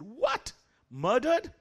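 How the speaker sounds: high, loud gasping what; shocked disbelief. Retold or imitated. Teacher hears his student's mother was murdered, shocked high cry. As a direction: recoil in disbelief at the news.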